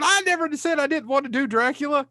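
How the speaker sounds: high-pitched mock yell; imitated yelling. Co-host throwing his friend's Dracula complaint back at him, mock yelling. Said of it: "you started yelling at me going".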